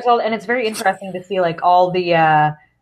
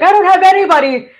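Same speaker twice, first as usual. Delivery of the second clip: quoting Kevin Owens; 7 semitones higher, 3 dB louder than usual. Retold or imitated. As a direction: play the lonely, abandoned wrestler. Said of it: "you did hear him say where he's like".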